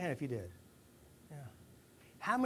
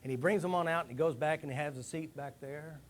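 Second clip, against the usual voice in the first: normal talking voice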